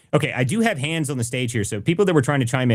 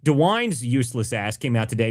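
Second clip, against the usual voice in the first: usual speaking voice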